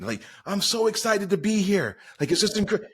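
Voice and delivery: high-pitched, gushing stage enthusiasm; mock excitement. Speaker imitating people on stage thrilled to be there, mock excitement. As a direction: imitate an overexcited speaker greeting a crowd. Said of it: "How could they be excited?".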